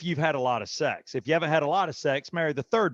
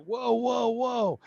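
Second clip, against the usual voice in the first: mock surprise; 8 semitones higher, much slower than usual. Retelling shock in the car.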